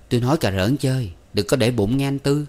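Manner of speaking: ordinary delivery, nothing marked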